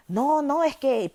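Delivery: guardia respondiendo más alto y más agudo; mismo tono alzado. Retold or imitated. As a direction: sube la voz para igualar el enfrentamiento. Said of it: "pero el, al mismo tono". (guardia que responde alzando la voz al mismo tono)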